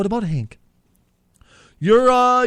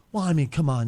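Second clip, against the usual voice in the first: ordinary delivery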